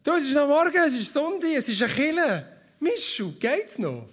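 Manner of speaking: high, loud hammed-up protest; flustered refusal. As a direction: squeal out a nervous no. Retold flustered refusal to wife.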